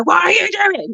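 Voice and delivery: put-on jokey high yell into a child's room; mock shout. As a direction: bellow it to show how not to wake a child. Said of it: "go into the room and go".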